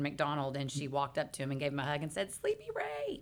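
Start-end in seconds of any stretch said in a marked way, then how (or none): 2.3-3.2 high, soft mock greeting; imitated delighted greeting. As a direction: imitate a high-pitched, soft hello to an old friend. Greeting an old acquaintance, retold.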